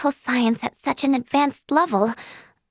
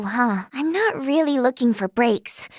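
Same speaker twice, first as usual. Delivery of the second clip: ordinary delivery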